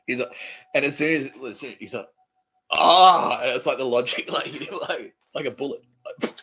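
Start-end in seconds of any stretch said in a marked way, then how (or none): 2.7-3.4 loud, drawn-out "ah" of dawning realisation; mock loud gasp of understanding; retold realisation. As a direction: act out the penny suddenly dropping, loudly. Retold man's sudden realisation.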